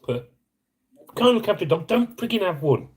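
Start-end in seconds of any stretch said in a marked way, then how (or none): 1.2-2.9 raw, high-pitched outburst of real anger; letting the fury out; wound up. Real, not acted. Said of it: "I'm so wound up about it".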